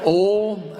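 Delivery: "Ole" for "oil", one drawn syllable; Southern "ole". Shown as a demonstration. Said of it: "I say ole".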